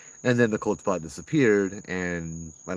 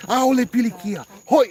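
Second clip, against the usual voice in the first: much higher, much louder mock banishing shout; Hawaiian yell; 10 dB louder than usual. Uncle Joe yelling in Hawaiian at the spirit, dramatic shout. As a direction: bellow it like Uncle Joe chasing off a ghost. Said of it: "yell in Hawaiian at you".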